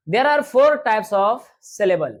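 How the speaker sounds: slow, even dictation pace for the class; slow teacher dictation. Shown as a demonstration. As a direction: read it out slowly so students can write. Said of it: "teacher slowly dictates the sentence".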